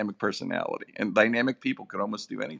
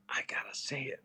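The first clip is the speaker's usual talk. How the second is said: breathy whispered aside, no voice; whisper to self; 12 dB quieter than usual. Retold or imitated. Guest whispering to himself before approaching Tyson, hushed whisper. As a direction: whisper it as if psyching yourself up.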